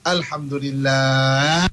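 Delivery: "Alhamdulillah" stretched very slowly, low and calm; slow, drawn-out Alhamdulillah. Shown as a demonstration. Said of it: "mungkin intonasi berbeza". (ucap "Alhamdulillah" perlahan dan panjang)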